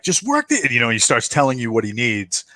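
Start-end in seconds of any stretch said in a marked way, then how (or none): 0.0-0.7 high, hurried instruction; pilot voice. Acted. Co-pilot instructing Nick at the controls. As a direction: tell him quickly what to do. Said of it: "he's like".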